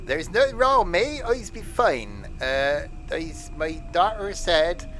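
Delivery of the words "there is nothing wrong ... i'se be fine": put-on Somerset accent. Joking as injured Steve.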